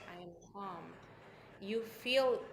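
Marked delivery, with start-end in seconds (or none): 0.1-0.9 very soft, lowered voice; hushed, settled affirmation; truly calm. Shown as a demonstration. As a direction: say it gently, as if to yourself. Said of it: "that you are actually calm".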